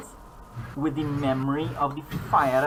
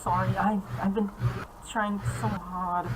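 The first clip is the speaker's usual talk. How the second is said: pleading ghost; 7 semitones higher than usual. Acted. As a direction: plead that you tried hard.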